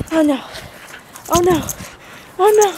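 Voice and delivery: mock alarm, climbing up; playful rising oh no. Real, not acted.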